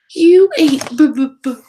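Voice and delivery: stammering in disbelief, slow; stuttering. A narrator's reading. A bat stammers in shock at the bug story. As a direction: bat stutters over the word bugs. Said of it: "stuttered one".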